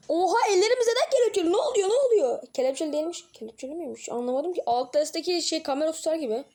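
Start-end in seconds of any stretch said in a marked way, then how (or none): 0.1-2.5 high, loud startled exclaim; shocked what's happening. Real, not acted. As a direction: burst out in alarm. Startled by handcuffs in game.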